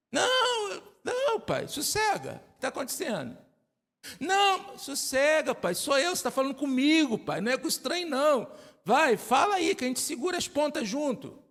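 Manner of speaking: high-pitched son's voice, coaxing his dad; a teen gently calming his father down; playful son's voice. Acted. Son reassures his worried father.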